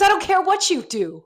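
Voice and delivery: lightly voiced indifferent man; imitating the staff. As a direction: shrug it off as the staffer. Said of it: "He goes".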